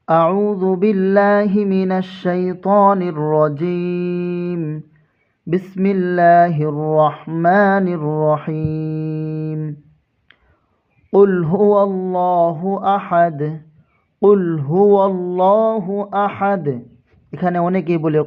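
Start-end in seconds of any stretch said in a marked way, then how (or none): 0.1-9.6 slow, melodic tajweed recitation; Quran recitation. Shown as a demonstration. Opens recitation with taawwuz. 11.1-16.7 slow, melodic recitation of the verse; Quran recitation. Shown as a demonstration. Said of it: "চলুন আমরা সূরা ইখলাস মস্ক করি".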